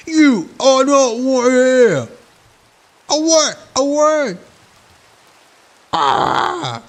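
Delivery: high, loud and slow possessed villager; Bloodborne enemy voice. Retold or imitated. Impressing a Bloodborne enemy for friends, high and loud. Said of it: "I can do the guys from Bloodborne".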